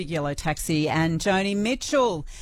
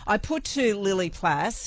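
ordinary talk

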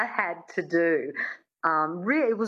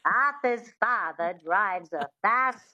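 slow, theatrical Mid-Atlantic accent demo. Shown as a demonstration. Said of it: "have a go at the Mid-Atlantic accent".

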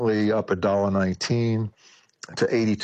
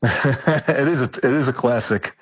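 laughing; 5 semitones higher, 3 dB louder than usual. Real, not acted. Laughs at the greeting question.

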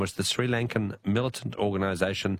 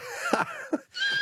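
high laugh. Real, not acted. Host laughing at caller.

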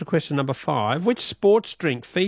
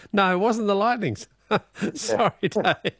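higher voice breaking into real laughter; amused, laughing apology; 5 semitones higher than usual. Real, not acted. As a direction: let the caller down through giggles. Host laughs at caller's wrong answer.

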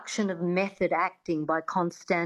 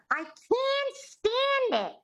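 Lena Lamont's shrill whine; 14 semitones higher than usual. Retold or imitated. Accent coach imitating Lena Lamont's squeaky film-star voice. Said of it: "very famously says".

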